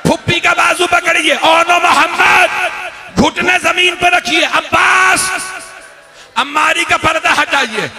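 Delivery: the same orders now high and wailing; escalated lament. Acted. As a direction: repeat the list as a cry of grief. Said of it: "मौला हुसैन ने आवाज दी".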